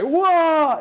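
high, loud burst of surprise; excited whoa. Real, not acted. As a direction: shout it like you're launched upward. Momentum sends them flying up.

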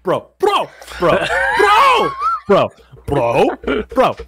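high squawky repeated bro; bird voice. Pretend. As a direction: chirp it like a little bird. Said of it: "doing Michael talking to Dimitri".

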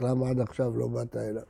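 low, hushed, subdued self-reproach; retold tearful question. Weeping rabbi asks why he never came to God, hushed and subdued. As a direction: drop low and very soft, as if quoting a man in tears. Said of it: "אז הוא התחיל לבכות".

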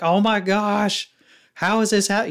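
high, drawn-out mock gushing; emotional bridesmaid. Bridesmaid reacting to how amazing the bride looks, emotional. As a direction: imitate an overwhelmed bridesmaid in a high voice. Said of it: "bridesmaids are emotional".

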